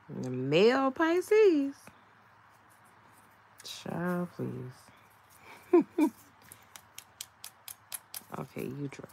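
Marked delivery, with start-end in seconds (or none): 0.1-4.7 high, loud and very slow "male Pisces"; exasperated drawl. Acted. Mocking male Pisces to viewers, high, loud and drawn out. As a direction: stretch it out with heavy exasperation.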